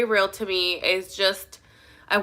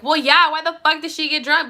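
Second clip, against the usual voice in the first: indignant retort; 6 semitones higher, 3 dB louder than usual. Acted. Acted retort to his accusation.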